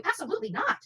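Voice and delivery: ordinary delivery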